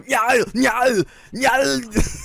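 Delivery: high, strained "nyah" wail; comic impression of Arnold screaming his head off; drawn-out high-pitched choking cries; imitated Arnold screaming. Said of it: "it's just Arnold screaming his head off".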